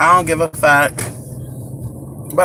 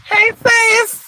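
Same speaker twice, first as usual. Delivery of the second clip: bright greeting; 13 semitones higher than usual. Real, not acted. Greeting a viewer.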